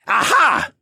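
high, loud cry of triumph; joyful shout. A narrator's reading. Assassin finally frees himself, shouting with joy. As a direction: shout it with sudden glee. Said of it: "shout with joy".

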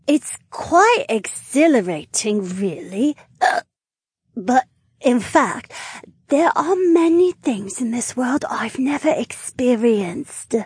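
giddy tipsy lizard mage; tipsy woman voice. Acted. Drunk Naomi voiced.

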